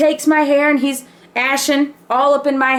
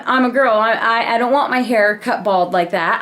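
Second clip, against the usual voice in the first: ordinary talk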